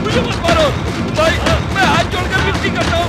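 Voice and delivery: high, loud desperate pleading cries; terrified begging. Acted. Man pleading with attackers to spare him, terrified high screams. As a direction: beg for your life, screaming in panic.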